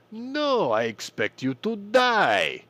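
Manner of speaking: high, loud, slow villain line in a put-on voice; loud theatrical villain delivery; Bond villain. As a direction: ham up the cold movie villain. Bond villain quote, retold verdict.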